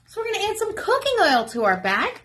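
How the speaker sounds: ordinary delivery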